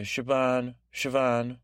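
Siobhan pronounced deliberately, each time punched; punched names. Shown as a demonstration. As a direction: say "Siobhan" twice as a careful, emphatic try. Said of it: "I really punched those there".

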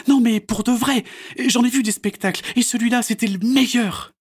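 put-on high, loud little-girl voice; bright-eyed child gushing; Mélanie's voice. A narrator's reading. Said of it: "dit Mélanie". (voix de fillette émerveillée)

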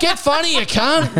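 very high mock heckle; abusive heckler. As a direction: hurl it like an indignant punter, for laughs. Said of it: "is still abusing me".

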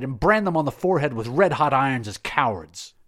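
furious shouted order; cried out. Acted. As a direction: bellow the order across the garden. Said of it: "he cried".

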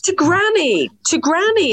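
high incredulous to granny, to granny; shocked repeat of granny; mock shocked. Real, not acted. Host exclaims in mock shock.

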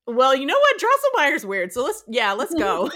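rally a friend eagerly; eager and loud. Retold or imitated. Clara eagerly agreeing.

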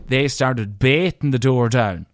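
ordinary talk, nothing marked

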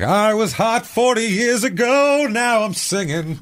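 high, slow, fading mock diva; old diva. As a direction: put on a tired old diva voice. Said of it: "she's like".

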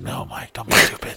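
hushed, voiceless self-talk; whispered self-warning. Retold or imitated. Man hiding, telling himself to stay, whispering.

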